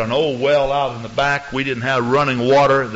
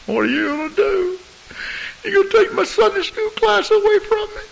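high, pleading falsetto; whiny pleading. Retold or imitated. Church member begging the pastor to keep the class, high whine.

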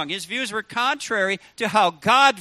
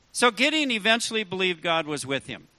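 ordinary talk

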